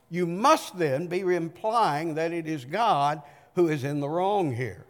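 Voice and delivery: usual speaking voice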